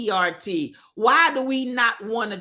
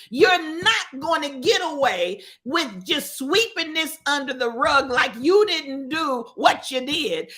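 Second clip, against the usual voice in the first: ordinary delivery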